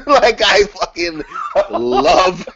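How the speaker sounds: high, slow, laughing through love; so excited he laughs mid-word; laughing gush. Real, not acted. Raving about the match to listeners, loud laughing gush.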